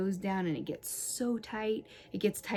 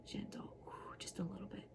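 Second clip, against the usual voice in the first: much softer, whispered coaxing over the tender spot; 15 dB quieter than usual. Real, not acted. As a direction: ease off into a soft whisper. Pressing the tender bony spot.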